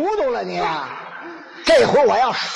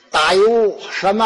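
usual speaking voice

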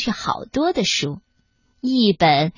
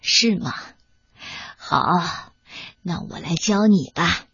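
put-on old grandmother voice; 6 semitones lower than usual. Grandmother agrees to teach.

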